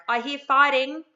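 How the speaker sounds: loud, matter-of-fact parental announcement; acknowledging call-out. Shown as a demonstration. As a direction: call it out loudly so they know you've heard. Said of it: "in a tone that basically".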